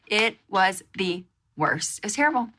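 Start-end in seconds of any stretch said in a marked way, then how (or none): none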